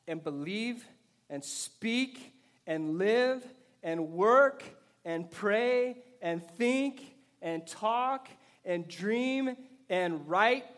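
very slow, each verb drawn out and set apart; stretched out. Real, not acted. Pastor challenging church with one long question, stretched out slowly. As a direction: draw out every word of the list. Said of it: "All right, let's stretch this out".

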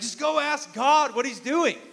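high, loud fatherly urging; a dad gently pushing his son toward God; the father, high and loud, sending his son to pray; dad's voice. Retold or imitated. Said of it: "my dad's like".

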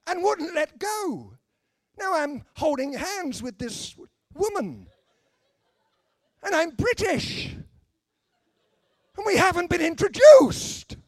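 high, loud, drawn-out comic horror; hammed-up indignation, building to a shout; mock outrage. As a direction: exclaim it as a scandalised Brit. Mock British horror at hand-holding.

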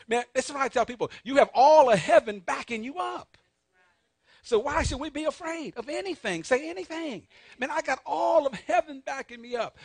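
high, loud preacher climax; fired-up sermon peak; rousing preaching. Real, not acted. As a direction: lift the room, raise the pitch and volume.

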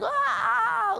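sudden high wail, hammed up; a burst of child's crying, mocked; mock toddler wail. Dad retelling his daughter's meltdown, high mock wail.

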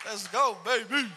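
loud, excited call to his wife; eager. Retold or imitated. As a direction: pump it up for her.

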